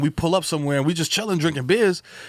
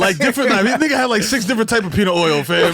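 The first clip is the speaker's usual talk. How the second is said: hyped riffing; 5 semitones higher, 5 dB louder than usual. Acted. Hyped riff on peanut inventions.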